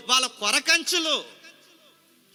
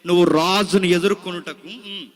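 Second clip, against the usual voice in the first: scripture recitation. Acted. Preacher echoing the Bible reader's verse line by line. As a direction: echo the reader solemnly.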